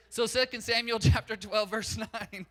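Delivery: cracking up while citing the chapter; laughing through it. Real, not acted. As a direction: giggle your way through the reference.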